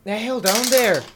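calling out. Acted. Character calls to companion climbing off, calling out. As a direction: call down in alarmed disbelief.

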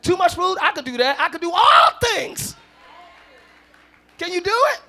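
loud, rising preacher cadence ending in a shout; full-force preaching. Acted. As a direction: preach it to the church, building to a shout.

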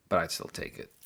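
low whisper; a sneaky confession under the breath; whispered aside. Acted. Host admits he would keep the money, whispered aside. Sly aside after the lecture.

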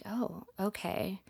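soft, slow, taken aback; subdued surprise. Retold or imitated. Woman recalling his unexpectedly kind reaction, quiet surprise. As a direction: take it in quietly and slowly.

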